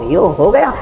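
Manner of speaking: ordinary talk, nothing marked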